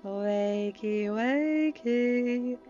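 slow, drawn-out mocking singsong; taunting wake-up call. A narrator's reading. Uma waking the captive, taunting singsong. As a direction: stretch it out tauntingly. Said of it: "Uma sighed, an almost feral grin".

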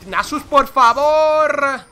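high, loud, slow begging; exasperated plea. Real, not acted. Gamer begging teammate Nasus, loud drawn-out plea. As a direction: drag it out pleading with your teammate.

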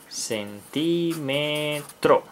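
"Centímetro" drawn out slowly, syllable by syllable. Shown as a demonstration. Teacher sounding out "Centímetro" for children, slowly syllable by syllable. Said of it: "con acento en la I".

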